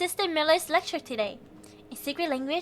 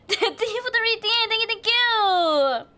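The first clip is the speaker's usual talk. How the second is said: sing-song thanks; 5 semitones higher than usual. Real, not acted. Thanking a viewer on stream.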